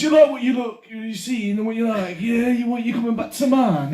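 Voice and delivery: high-pitched Yorkshire pick-up line; flirty Yorkshire lass. Acted. Friend plays a drunk Yorkshire woman chatting someone up, Yorkshire accent. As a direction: chat him up in a common Yorkshire voice.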